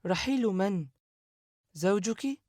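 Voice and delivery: puzzled by the answer, ask gently; surprised. A narrator's reading. Sara surprised asking her sister whom she means. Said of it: "سارة بتعجب".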